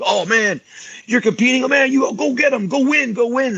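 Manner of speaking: a hyped-up fan egging him on; mock hype. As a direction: ham up the pushy hype guy. Mocking hyped-up cheerleaders.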